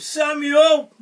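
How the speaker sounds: high, loud storybook call. A narrator's reading. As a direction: call loudly to rouse a sleeper. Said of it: "But when God called".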